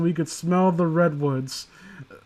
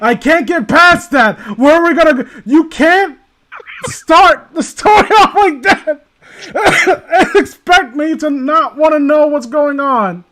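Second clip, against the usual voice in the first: laughing outrage; 10 semitones higher, 10 dB louder than usual. Real, not acted. Reader reacting to the dog story, laughing loud outrage. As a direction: shriek in disbelief while cracking up.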